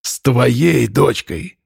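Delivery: slow, croaked retort; hoarse. A narrator's reading. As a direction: rasp it out slowly, drawing out the words. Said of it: "прохрипел Степан". (медленно, хрипло)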